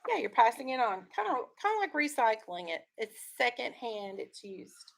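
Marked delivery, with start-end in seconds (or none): none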